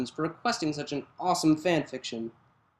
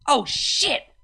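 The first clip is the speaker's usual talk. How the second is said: muttered curse; 9 semitones higher, 4 dB louder than usual. Acted. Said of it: "Vinyl muttered".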